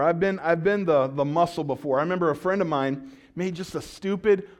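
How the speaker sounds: ordinary talk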